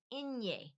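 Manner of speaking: inye said EN-yay with a palatal ny; Spanish letter name eñe. Shown as a demonstration. Teaching learners the letter after N, inye as EN-yay. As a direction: say inye as 'EN-yeh'. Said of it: "after the N is the".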